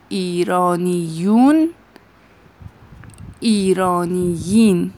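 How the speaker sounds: "ایرانیون" then "ایرانیین", each stretched slowly with clear endings; slow model pronunciation. Shown as a demonstration. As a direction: model both plural endings slowly for the class. (تلفظ آهسته و شمرده)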